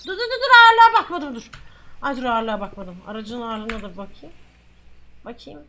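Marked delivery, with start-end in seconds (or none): 0.1-1.5 high, loud rapid-fire stop; urgent halt. Real, not acted. Officer halting the check over forgotten weight, urgent halt.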